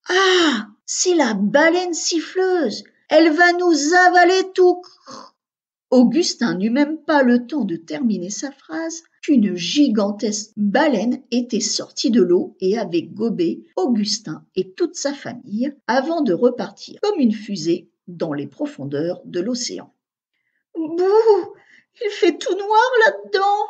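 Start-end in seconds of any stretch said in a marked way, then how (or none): 0.1-4.8 higher and louder, a startled cry of discovery; excited cry. A narrator's reading. Augustin warns his family of the whale, alarmed cry. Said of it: "s'écria". 20.8-23.7 put-on high little girl voice, whimpering; a scared small child in the dark; little sister voice. A narrator's reading. Agathe inside the whale, frightened little sister whimper. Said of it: "lança Agathe, la petite sœur".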